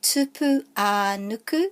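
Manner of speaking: "tupu a nuku" with a long a; stress on A. Shown as a demonstration. Teacher giving a similar name, long stressed a in "tupu a nuku". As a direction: hold the middle a in "tupu a nuku". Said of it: "So there's an emphasis there".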